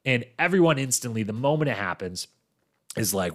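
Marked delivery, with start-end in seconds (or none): none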